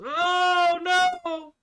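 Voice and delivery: the devil yelps in panic when the lights go out; devil's horrified cry. Acted. Devil in the dark.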